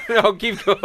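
talking through laughter; giggling while you speak; laughing. Real, not acted. Laughing fit during the bit.

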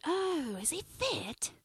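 soft mock English girl; softly put-on English accent. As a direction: imitate an English girl asking, lightly. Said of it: "The accents are really heavy".

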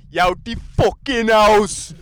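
high, slow bellow imitating an irate father; dad's angry yell. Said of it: "my dad would just say the same shit".

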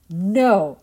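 loud, flat refusal; firm no. A narrator's reading. Invisible being's sister refusing the liars, firm loud no. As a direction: shut the lie down.

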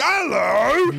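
high, loud mock Cockney greeting; Michael Caine. Comic mimicking England's poorest people, exaggerated British accent. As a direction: belt out a drawn-out British hello. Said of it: "They sound like fucking Michael Caine".

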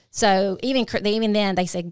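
ordinary delivery

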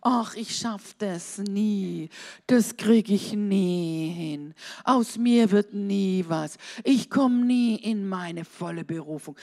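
low, dragging, hopeless drone; hammed-up dejected resignation; mock defeated self-talk. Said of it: "und du sagst".

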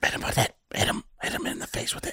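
hushed, breathy egging-on under his breath; whisper. Real, not acted. Whispering to a friend, urging him to share his fun fact. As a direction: whisper it to your friend like a secret cheer.

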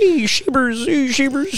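high, squealed pet-name calls; doting dog-lover uncle. Retold or imitated. Nephew mimics uncle petting dog on the deck, high gushing squeal.

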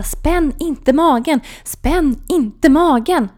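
slow, high, loud insistence; repeated urgent command. Retold or imitated. Nurse rolling patient onto side, slow loud urgent repeats. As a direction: drill every word in loud alarm. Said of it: "fortsätter upprepa".

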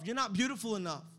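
usual speaking voice

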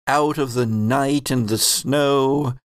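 ordinary delivery

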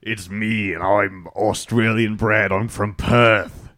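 slow, drawn-out mock Aussie; hammed-up Australian, stretching the last word; Australian accent. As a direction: introduce yourself in a put-on Australian accent. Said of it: "when you said Perth, that actually sounded pretty good".

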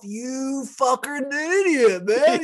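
laughing, hammed-up taunt; mock insult. Friend acting the biker mocking the lip ring, rising high.

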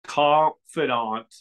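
Confidant stretched out very slowly, ending "-dahnt"; slow, exaggerated "Confidant" with an open last vowel; careful pronunciation. Shown as a demonstration. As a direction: sound out Confidant syllable by syllable. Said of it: "It is Confidant".

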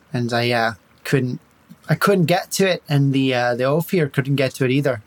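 light Irish lilt, charming and evasive; Irish accent. Acted. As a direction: talk the bandits round in a mild Irish brogue. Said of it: "Now you're making me Irish".

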